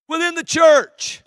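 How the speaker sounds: loud, high preacher's cry; emphatic shouted repeat. Real, not acted. Preacher warning congregation of civil war, emphatic shout. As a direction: drive it home at full voice.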